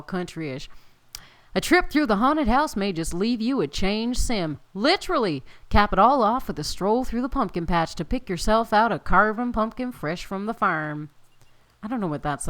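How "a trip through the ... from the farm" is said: louder, bouncy ad-style read; announcer read. Acted. Gamer reading the festival card aloud, hammed-up announcer. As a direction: pitch the festival like a town crier.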